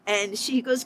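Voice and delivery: ordinary delivery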